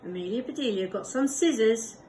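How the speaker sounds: usual speaking voice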